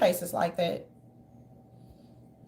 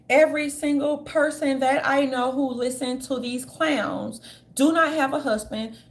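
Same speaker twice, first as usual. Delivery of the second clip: ordinary delivery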